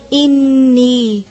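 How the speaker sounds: انی with the ن held long in the nose; انی with a slow ghunna; nasal hold. Shown as a demonstration. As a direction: hum the ن of انی slowly. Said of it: "آواز کو تھوڑی دیر ٹھہراتے ہیں ناک میں".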